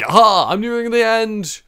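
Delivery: loud, high, hammed-up gloat; mock triumphant cry. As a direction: crow like you've already won the race. Mimicking himself during Trials race.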